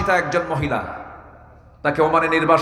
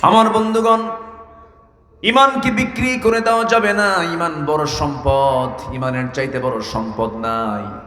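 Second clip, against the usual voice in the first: melodic sermon storytelling; 3 semitones lower than usual. A narrator's reading. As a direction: intone the tale in long sustained notes.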